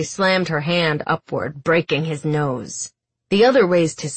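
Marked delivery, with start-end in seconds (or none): none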